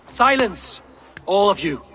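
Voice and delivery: high-pitched, imperious order; commanding rebuke. Acted. Ruler silences the quarrelling gods, commanding.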